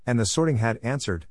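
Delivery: usual speaking voice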